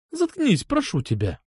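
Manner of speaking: high start sinking into a groan; pleading moan. A narrator's reading. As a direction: beg him, worn out, voice falling. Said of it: "простонала она".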